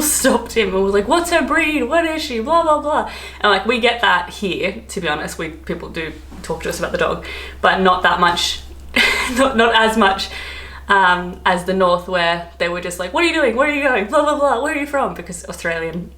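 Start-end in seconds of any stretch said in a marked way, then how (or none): 1.1-3.1 high-pitched, chirpy mock questioning; eager strangers imitated. Retelling strangers stopping to ask about the dog, high mock-eager voice. 13.1-15.1 high, singsong run of questions; chatty northerners imitated. Imitating northerners chatting to an Australian, high mock-curious voice.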